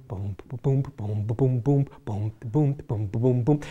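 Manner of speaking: soft low boom boom of a jukebox bass; quiet imitated bar music through the phone; imitated bass thump. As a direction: mimic music heard down a phone line.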